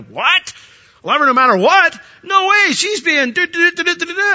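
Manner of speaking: high, loud disbelieving objection; incredulous outburst. Acted. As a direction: balk loudly, then rattle off the complaints. Acted incredulous reply to husband's claim.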